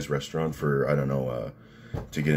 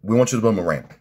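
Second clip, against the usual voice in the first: louder, higher mobster impression; punched-up comic gangster voice; Paulie Walnuts; 6 semitones higher, 7 dB louder, faster than usual. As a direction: imitate a Sopranos wiseguy making a demand. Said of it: "Paulie Walnuts goes".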